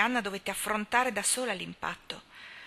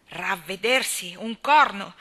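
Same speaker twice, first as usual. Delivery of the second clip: slow then loud, scornful retort; 11 dB louder, slower than usual. A narrator's reading. Miss Cornelia retorting to Anna, loud scorn. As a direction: fling it back at her. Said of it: "ribatté la signorina Cornelia".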